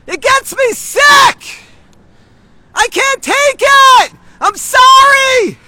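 slow, high, full-force shouted rant; furious screaming. Real, not acted. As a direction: scream it out at the whole street, stretching the words. Furious street rant at protesters.

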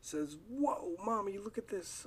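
put-on high, eager child calling Mommy; child showing mommy a new Pokemon, high and eager; excited kid voice. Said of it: "your kid comes up and says".

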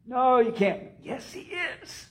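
put-on high, squeaky, flustered girl. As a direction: squeak it out like a panicked servant girl. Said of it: "in a high, squeaky voice".

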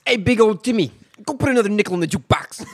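loud, high Italian-American mobster holler; big, booming Italian-American accent. Acted. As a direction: yell it like a hammed-up Italian-American wiseguy. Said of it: "I wish I had an Italian accent".

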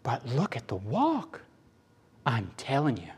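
soft, urgent acted aside; hushed insistence. Angel pointing out the family walk, hushed insistence. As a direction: press the point quietly.

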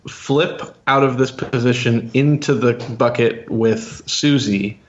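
usual speaking voice

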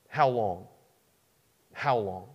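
low, soft, falling question; weary frustration. Acted. Dying David questioning God, low frustrated plea. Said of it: "This here is a cry of frustration".

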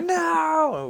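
very high, drawn-out mock wail; retold outcry. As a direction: cry it out high and long, imitating an outburst. Acts out winner's cry.

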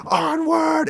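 very high, put-on voice for the rallying cry; mock battle cry. Bo-Katan rallying her Mandos, in a squeaky put-on battle cry. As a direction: yell it like a cartoon warlord. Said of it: "and says".

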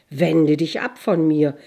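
loud, commanding call from the sun. A narrator's reading. Sun orders the girl away, calling out loudly. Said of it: "rief die Sonne".

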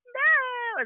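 very high, stretched-out wail; mock protest. Daughter denying it a second time, mock wail. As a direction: squeal the denial like a teen. Daughter denies again, exaggerated.

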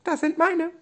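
tiny, very high squeak; squeaky dolphin voice. Acted. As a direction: squeak like a small toy dolphin. Delfin streitet um Karotten. (hohe, piepsige Delfinstimme)

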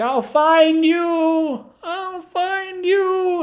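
high, slow, long-drawn mock yell; calling after someone. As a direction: stretch each word out as a cry chasing someone escaping. Pursuer calling after fleeing narrator.

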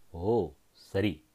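low, soft, slow seething hiss; quiet venomous "ஓ, சரி"; hissed reply. A narrator's reading. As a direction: bite back the anger and hiss it out low. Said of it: "Venkanna சீறினான்".

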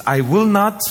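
"I will not" pitched high with a firm push on "not"; emphatic negative. Shown as a demonstration. Preacher showing the three Greek negatives, emphatic. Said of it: "these three negatives are this".

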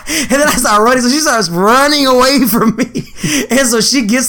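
giggling through the story; laughing as he tells it. Real, not acted. Laughing retelling chase.

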